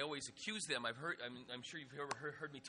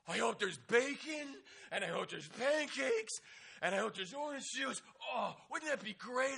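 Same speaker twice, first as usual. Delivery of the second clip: excited odd camper; 8 semitones higher, 8 dB louder than usual. Retold or imitated. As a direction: savour each breakfast wish with glee. Donald dreams of breakfast aloud.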